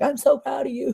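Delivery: high, imitated happy-crying voice; tearful pride. Telling baby sister through tears, tearful pride. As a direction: choke it out, proud and high. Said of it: "I was crying to her".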